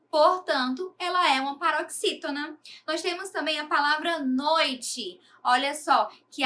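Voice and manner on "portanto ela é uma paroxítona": usual speaking voice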